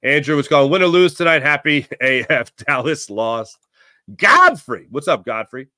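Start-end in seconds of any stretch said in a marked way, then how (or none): 4.2-4.8 loud, high shout-out; calling out. Real, not acted. Host greeting a chatter by name, loud call. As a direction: hail them with a high shout.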